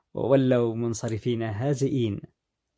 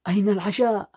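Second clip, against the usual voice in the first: high-pitched, irate demand; angry shout; 9 semitones higher, 4 dB louder than usual. A narrator's reading. Husband finds no dinner and yells at his wife, angry shout. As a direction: burst out at her in outrage. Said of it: "عاد ليصرخ في وجه زوجته غاضبا".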